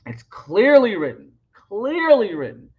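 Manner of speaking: "clearly" stretched out slowly, with a big pitch swoop; emphatic stress. Real, not acted. Speaker insisting on what the book says, "clearly" drawn out emphatically. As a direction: lean long and hard on "clearly" both times.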